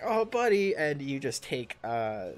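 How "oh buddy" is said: high, louder sympathetic cry; pitying oh buddy. Acted. GM's character pities the shocked Skullface, high and loud. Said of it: "and I'm like".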